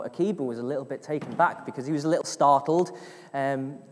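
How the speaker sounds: ordinary talk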